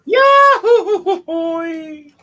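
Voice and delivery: high, loud whoop of glee; yahoo cheer. Real, not acted. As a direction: whoop it out with joy. GM cheers the blast.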